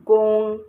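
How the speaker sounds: gong loud, high and held level; flat tone. Shown as a demonstration. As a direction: sustain gong evenly without rise or fall. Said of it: "because it is flat tone".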